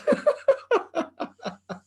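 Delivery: high burst of laughter; real amused laughter. Real, not acted. Teller bursts laughing at story.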